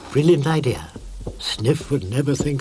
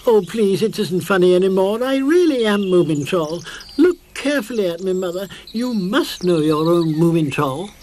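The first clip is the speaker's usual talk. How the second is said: high, loud desperate pleading; 6 semitones higher, 4 dB louder than usual. A narrator's reading. Moomintroll begs his mother to know him. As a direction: beg to be recognised.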